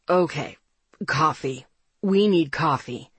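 low, decisive male voice; deep man needing coffee; rich baritone. A narrator's reading. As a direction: state it in a deep voice. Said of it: "in that rich baritone that I loved".

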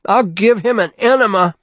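screamed. A narrator's reading. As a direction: scream it out of a nightmare. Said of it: "Bruce screamed".

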